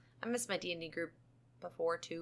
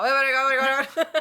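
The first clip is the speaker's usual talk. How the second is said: loud; 5 semitones higher, 9 dB louder than usual. Real, not acted. Cutting in, calling for pause.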